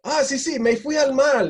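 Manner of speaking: high, loud imitation of agreeing; eager confirmation. Islander tells gringos they finally understood, eager confirmation. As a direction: confirm it keenly, pitched well up.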